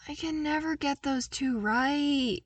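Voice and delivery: whiny, high complaint; complaining. Acted. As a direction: grumble it like a frustrated kid. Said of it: "he complained".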